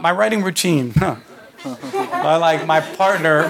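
normal talking voice

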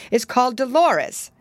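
high, indignant question; horrified indignation. A narrator's reading. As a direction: end on an appalled, high-pitched challenge. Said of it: "much horrified".

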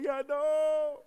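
long, loud, high agreement; mock panicked wail. Husband answering his panicked wife, mock panicked wail. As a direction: stretch it out in hammed-up alarm.

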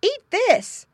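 high, loud coaxing; high loud farm wife voice; coaxing the pig. A narrator's reading. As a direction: offer the pig a treat.

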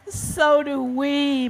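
slow, drawn-out mild moan; moaning pandas. Acted. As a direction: moan it slowly in self-pity. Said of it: "moaned the pandas".

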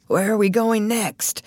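lightly voiced hoarse old actor. A narrator's reading. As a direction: ask through a hoarse throat. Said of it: "He spoke in very hoarse voice".

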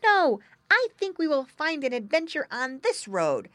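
high, loud contrary piglet; younger pig brother. A narrator's reading. Younger brother picks the other road, high and insistent. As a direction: disagree in a high little pig voice. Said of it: "said his younger brother".

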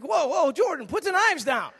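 acting out a dad reining in his kid; parental warning. Father to son flipping steak knives, urgent warning.

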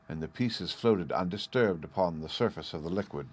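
usual speaking voice

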